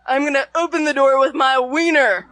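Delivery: lightly voiced creepy guy; a hint of a creepy leer. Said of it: "in a very creepy voice".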